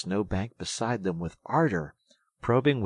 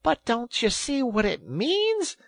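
very high, loud exasperation; impatient cry; 9 dB louder than usual. A narrator's reading. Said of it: "cried the rat impatiently".

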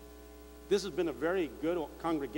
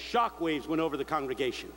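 ordinary delivery

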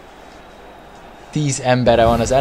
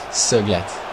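ordinary talk

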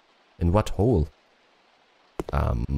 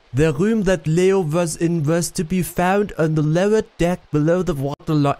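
high-pitched mock French accent; attempted French accent; 7 semitones higher than usual. Player trying to read the letter with a French accent, high and overdone. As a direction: push a French accent up high, badly faked. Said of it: "I can't do a French accent".

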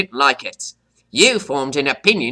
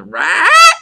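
a sharp, high, louder squawk; parrot squawk; 6 semitones higher, 7 dB louder than usual. Acted. Parrot teacher squawking between words. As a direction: squawk it out like a bird.

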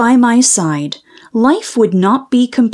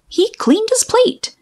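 much higher squeaky reply; high character voice; 11 semitones higher than usual. Acted. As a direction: pop the line up high like a cartoon pal. Character replies in story.

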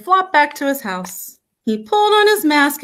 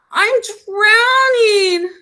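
high, drawn-out wail for help; panicked cry; 10 semitones higher, 4 dB louder, slower than usual. A narrator's reading. Froggy to mother in the pond, panicked high cry. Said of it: "cried Froggy".

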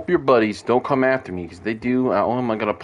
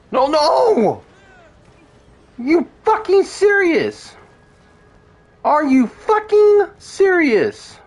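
high, loud, drawn-out furious disbelief; outraged yell; 6 dB louder, much slower than usual. Real, not acted. Player yells as the bounty jumps off the train, outraged. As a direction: yell it in outrage, stretching each word. Captive escapes, outraged yelling.

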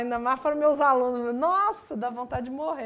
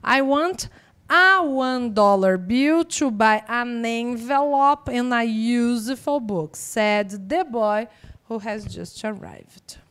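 leitura didática; 8 dB louder, much slower than usual. Shown as a demonstration. Lê a frase da prova.

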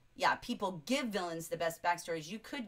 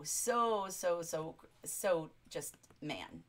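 ordinary talk, nothing marked

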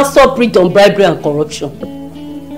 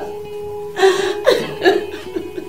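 broken sob; 9 semitones higher, 8 dB quieter than usual. Acted. Breaks down in prayer.

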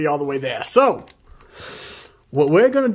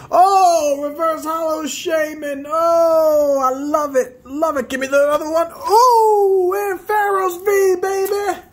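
excited shouting; 11 semitones higher than usual. Real, not acted. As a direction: shout with joy at the pull. Pulls a rare hit from pack.

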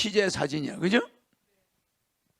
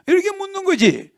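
excited; 10 semitones higher, 4 dB louder than usual. Real, not acted. Animated explanation, pitch jump.

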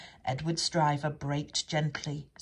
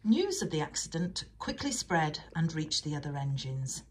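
usual speaking voice

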